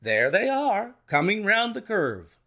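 excited cry. A narrator's reading. Detective seeing horses come round the curve, crying out. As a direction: cry out as you spot them. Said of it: "he cried suddenly".

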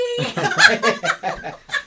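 loud, high burst of laughing; burst of laughter. Real, not acted. Speaker laughing at the gateway drug joke, loud burst. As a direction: crack up loudly.

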